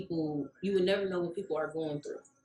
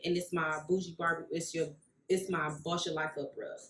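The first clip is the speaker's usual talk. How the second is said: ordinary talk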